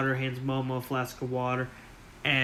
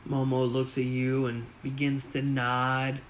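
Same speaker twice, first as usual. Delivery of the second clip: normal talking voice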